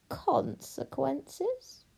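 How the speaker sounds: slow, high, rising echo; scornful witch. Acted. Witch repeats princess's threat, slowly scornful. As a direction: draw the word out in disbelief.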